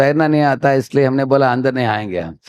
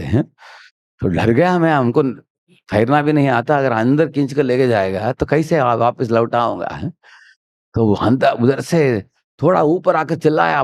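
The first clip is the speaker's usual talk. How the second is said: ordinary delivery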